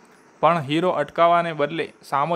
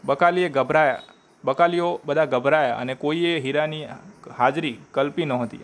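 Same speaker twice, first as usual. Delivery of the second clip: ordinary delivery, nothing marked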